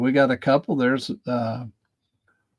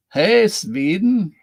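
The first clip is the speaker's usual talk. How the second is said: slow, lilting attempt at a Swedish accent, higher; bad Swedish accent; 7 semitones higher, 3 dB louder, slower than usual. Streamer greeting a Swedish viewer, in a weak mock Swedish accent. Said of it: "I don't know how to do a Swedish accent".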